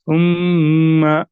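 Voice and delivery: सुम्मा held slow with a humming nasal म; nasalized recitation, held one alif long; nasal ghunna. Shown as a demonstration. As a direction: let the doubled म of सुम्मा ring through the nose. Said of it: "नाक में आवाज़ निकालनी है".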